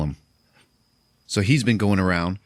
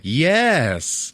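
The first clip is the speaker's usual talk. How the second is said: emphatic yes; 8 semitones higher, 4 dB louder than usual. Retold or imitated. Mira admits being haunted.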